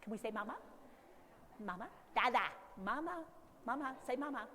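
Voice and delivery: a lightly played coaxing mom, soft rises; coaxing mother. A mother coaxing baby to say mama, softly. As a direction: gently coax the baby to say mama. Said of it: "what is mom doing the whole time".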